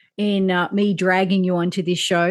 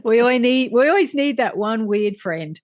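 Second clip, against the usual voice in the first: teasing through laughter; laughing. Real, not acted. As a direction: laugh while calling her the weird friend. Teasing weird friend, laughing.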